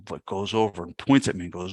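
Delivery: ordinary talk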